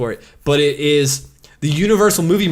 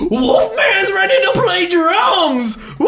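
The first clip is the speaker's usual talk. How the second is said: excited announcer; slower than usual. Retold or imitated. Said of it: "it's like".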